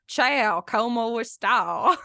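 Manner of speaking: put-on Italian in a thick East Texas accent. Imitating grandfather speaking Italian, deep East Texas accent. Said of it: "a deep East Texas accent".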